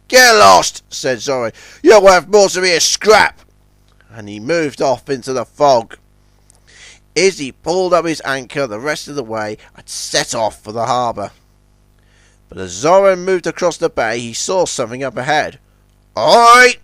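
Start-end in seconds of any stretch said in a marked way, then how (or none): 0.1-0.8 loud, higher brush-off; dismissive Zoran. A narrator's reading. Zoran turns down Izzy's offer, loudly dismissive. 1.8-3.4 louder, raised, scornful jibe; a forceful put-down; scornful Zoran. A narrator's reading. Zoran refuses to tow Izzy, scornful. Zoran rejects Izzy's offer. 16.2-16.8 loud, high hail; Zoran calling out. A narrator's reading. Zoran hails something across the bay, calling out. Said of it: "he called".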